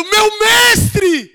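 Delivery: grito alto e muito agudo de reconhecimento; grito de alegria. Retold or imitated. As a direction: grite o nome dele, bem mais agudo e forte. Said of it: "Ela grita". (Maria reconhecendo Jesus e gritando de alegria)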